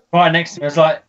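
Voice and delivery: usual speaking voice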